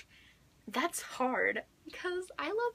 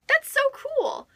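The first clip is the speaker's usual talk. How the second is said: much higher and louder, slow; excited squeal; 14 semitones higher, 6 dB louder, slower than usual. Real, not acted. Reader excited about the same artist, high squeal. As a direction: squeal with delight.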